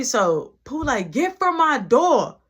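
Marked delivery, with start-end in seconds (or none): 1.1-2.4 loud, high, slow command; angry order. Retold or imitated. Pooh yelling at Tamika to leave her door. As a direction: shove her off your doorstep.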